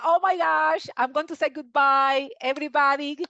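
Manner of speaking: normal talking voice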